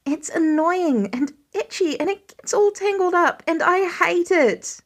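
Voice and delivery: a son on the verge of boiling over; frustrated teen. A narrator's reading. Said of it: "the edge in his son's voice, tinged with frustration".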